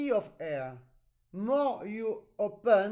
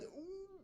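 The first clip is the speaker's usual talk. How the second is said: high, breathy ooh of effort; mock pained ooh; strained ooh; 10 semitones higher, 17 dB quieter than usual. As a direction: voice the student's strain. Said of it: "on your face now I feel".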